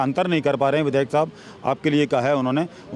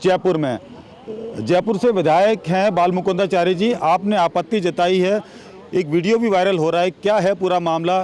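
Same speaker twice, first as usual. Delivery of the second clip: bright, punchy reporter lead-in, higher and louder; energetic news intro; 5 semitones higher, 3 dB louder than usual. Acted. Reporter introducing the MLA's hijab row to viewers, energetic news delivery. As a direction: hook the viewer into the story.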